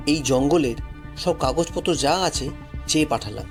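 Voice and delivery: ordinary delivery, nothing marked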